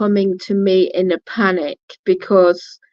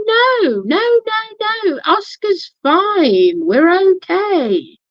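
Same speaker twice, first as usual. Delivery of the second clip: reassuring; 11 semitones higher, slower than usual. Retold or imitated. Reassuring the frightened ex.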